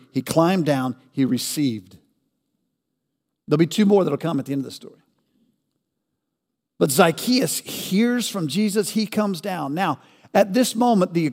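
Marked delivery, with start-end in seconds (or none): none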